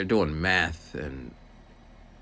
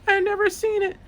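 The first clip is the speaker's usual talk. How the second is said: put-on much higher little girl's voice; young student voice; 4 dB louder than usual. Student telling the aide about groundhogs, in a high girl's voice. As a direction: say it like a puzzled kid.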